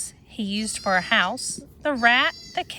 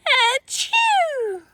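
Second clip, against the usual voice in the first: sneeze; 4 dB louder than usual. A narrator's reading. Flea sneezes in story.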